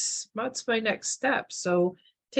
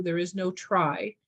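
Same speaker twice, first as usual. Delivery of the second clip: ordinary delivery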